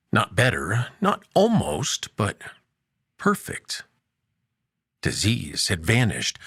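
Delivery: ordinary delivery, nothing marked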